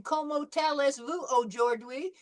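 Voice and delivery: put-on earnest American accent, "allez-vous" said "al-ess-voo", with a hard "ou-chor-dwee"; lightly imitated American accent on the French greeting. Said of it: "it was so un-French".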